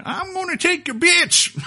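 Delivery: put-on high, slow crazy old prospector voice; cackling old prospector. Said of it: "as an old prospector going".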